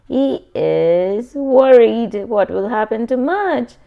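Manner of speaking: usual speaking voice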